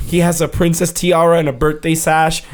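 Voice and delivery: usual speaking voice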